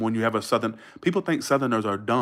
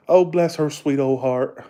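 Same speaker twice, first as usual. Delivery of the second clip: slow, louder Southern drawl of sympathy; Southern accent demo; 3 semitones higher, 5 dB louder, slower than usual. Shown as a demonstration. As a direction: draw it out like a pitying Southerner. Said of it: "You don't hear that in Vegas".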